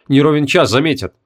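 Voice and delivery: usual speaking voice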